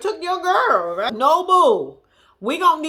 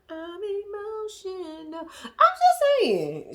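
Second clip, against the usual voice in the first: mocking whiny voice; 7 semitones higher, slower than usual. Mocking Shaw's emotions.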